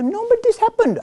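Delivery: very high, loud mock protest; mocking whiny voice. Adults insisting to the speaker that the story happened, whiny high. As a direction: parody a stubborn adult like a whining child. Grown Hindus insist stories are literal.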